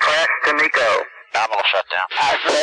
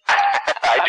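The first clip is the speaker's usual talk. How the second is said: laughing. Real, not acted. Crew laughing during launch.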